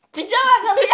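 high laughing accusation. Real, not acted. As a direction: laugh while calling him out. Laughing at friend cheating. (gülerek)

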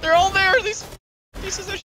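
high, urgent shout at the enemy; combat callout. Real, not acted. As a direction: yell the callout in panic. Frantic callout in a firefight.

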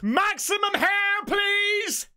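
high, drawn-out mock announcement; hammed-up call. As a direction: call it out high, stretching the words. Hammed-up catchphrase shouted at the film.

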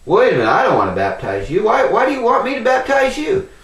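quick protest climbing high in pitch; puzzled protest. Acted. John objecting to baptizing Jesus, puzzled protest. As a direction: object quickly, acting out a baffled questioner.

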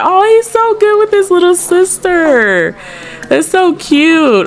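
high-pitched, adoring coo; gushing coo. Real, not acted. Gamer fawns over brother with little sister, high gushing coo.

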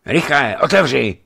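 loud, high-pitched hurrying order; urgent prodding. A narrator's reading. Rider urging the old watchman to open, urgent and loud. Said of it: "pobízel přicházejícího starého hlídače".

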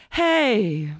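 high, put-on breezy "hey"; drawn-out greeting. Boss walking into an empty restaurant, drawn-out greeting. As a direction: sing out a long greeting. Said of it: "and going".

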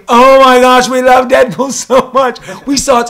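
very high, very loud giddy gushing with a laugh; hammed-up gushing of the ladies at dinner; gushing fans. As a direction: squeal like a starstruck fan. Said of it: "were telling me".

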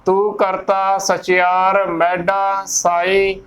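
slow, careful scripture line; slow recitation. Shown as a demonstration. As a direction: recite each word deliberately. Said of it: "ਮੇਡਾ ਨਹੀਂ ਬੋਲਣਾ".

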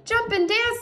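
high-pitched rooster voice. A narrator's reading. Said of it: "said the rooster".

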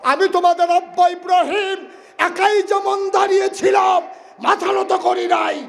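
high shouted prophet's vow; Ibrahim defiant. Acted. Ibrahim telling his followers never to bow, shouted high. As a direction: stand firm like a mountain, crying out.

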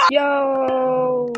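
loud excited yo; shout. Real, not acted. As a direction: yell it out. Shouting after a laugh.

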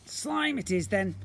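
booming slime creature. Acted. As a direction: play the magical blob, grand and eager.

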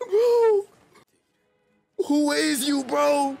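loud stunned question; shocked shout. Real, not acted. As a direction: yell it in disbelief. Shouted disbelief after screaming.

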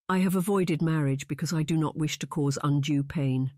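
ordinary delivery

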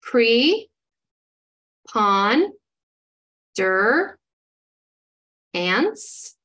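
"Pre, pon, der, ants" said as four separate spaced syllables; read by syllable parts. Shown as a demonstration. Teacher models chunking a word for students, syllable by syllable. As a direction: sound out "Pre, pon, der, ants" with a pause after each part. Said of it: "So here we go".

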